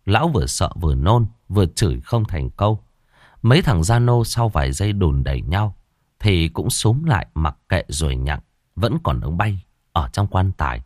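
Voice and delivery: usual speaking voice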